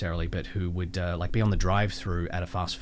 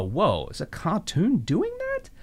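amazed disbelief; 10 semitones higher, slower than usual. Retold or imitated. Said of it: "you're like".

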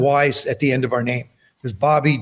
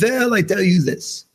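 lightly voiced old grandmother, higher and slow; a hint of granny, unhurried and higher; grandmother's voice; 6 semitones higher than usual. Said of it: "She says".

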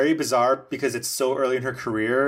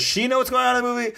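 high incredulous mock; 11 semitones higher, much faster than usual. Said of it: "I was like".

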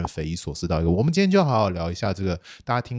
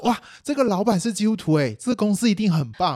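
high, eager starry-eyed voice; naive excited gushing; 10 semitones higher than usual. Retold or imitated. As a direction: gush like a naive new graduate. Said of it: "然后特别就想说".

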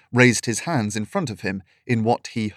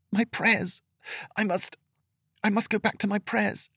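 tearful frail woman; 10 semitones higher, 4 dB quieter than usual. A narrator's reading. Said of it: "tears well up".

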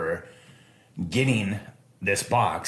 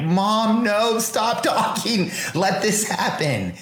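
put-on much higher, slow, urgent kid's voice; frantic inner plea; 12 semitones higher, much slower than usual. Boy silently begging mom not to refuse the gift, high and urgent. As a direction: beg her silently to stop talking. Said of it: "I remember thinking".

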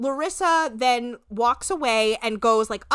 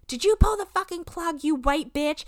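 mock angry quote, pitched higher; retold insult; 8 semitones higher than usual. [name] quoting Larissa's insult to Monique, mock angry and higher. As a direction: toss the insult in a higher, hammed-up voice. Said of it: "it was something like".

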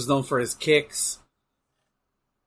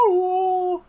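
high drawn-out "woo" sound effect; imitated distant scream. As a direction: mimic a faraway animal cry, held high. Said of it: "like, animal or scream or something".

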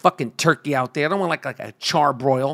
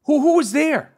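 much higher, pressing worried question; 10 semitones higher than usual. Retold or imitated. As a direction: demand who was there. Retelling questioning father.

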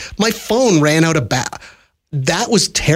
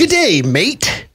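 raised, drawn-out Australian greeting; mock Australian accent; 6 semitones higher, slower than usual. Host jokingly returns from London with the wrong accent, mock Australian. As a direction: put on a slow, higher-pitched Aussie accent. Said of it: "Oh, wrong accent".

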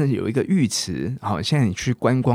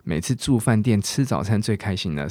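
ordinary talk, nothing marked

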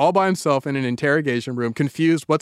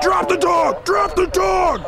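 high, barked repeated order; urgent yelled police order; shouting cops; 13 semitones higher, slower than usual. Acted. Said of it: "They shout back".

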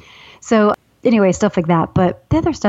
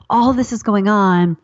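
ordinary delivery

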